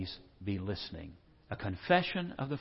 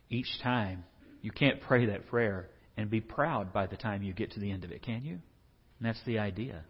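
usual speaking voice

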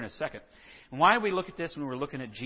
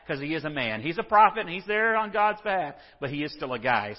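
ordinary delivery

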